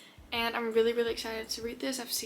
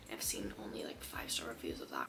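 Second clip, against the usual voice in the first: hushed whispered aside; 12 dB quieter than usual. Real, not acted. Confiding to viewers about the reviews in a whisper. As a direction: drop to a breathy secret.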